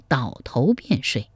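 ordinary delivery, nothing marked

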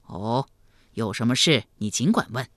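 deep, tender elder's voice; kindly, benevolent. A narrator's reading. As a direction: invite him gently, like a fond uncle. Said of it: "赵匡胤一脸慈祥地说".

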